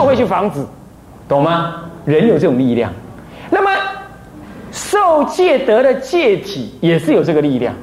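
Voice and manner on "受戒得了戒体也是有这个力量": usual speaking voice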